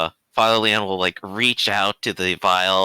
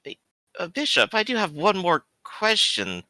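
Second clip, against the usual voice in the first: higher-pitched, inquisitive priest; Father Leon; 8 semitones higher than usual. Acted. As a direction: tentatively raise one more question as a priest. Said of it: "he's gonna kinda turn back and go".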